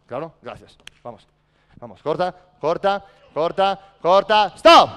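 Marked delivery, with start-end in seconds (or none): 2.0-4.5 building loud, higher calls; urging shout. Real, not acted. Coach urging a player to cut, building shout. 4.5-4.9 high, very loud stop shout; furious shout. Real, not acted. Coach stopping the drill, a high furious shout.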